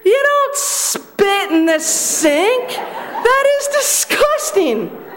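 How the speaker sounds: high, slow, hammed-up disgust. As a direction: act out your own shocked disgust, stretching each word. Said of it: "And then I was disgusted".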